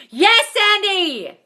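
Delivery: drawn-out, high and loud welcome; excited greeting. Real, not acted. Greeting a viewer by name on her live, excited. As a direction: sing out a happy hello to a friend.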